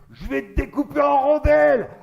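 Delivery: high, loud war cry; mock furious threat. Man leaping on stage with a sabre, mock fury. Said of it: "il s'énerve".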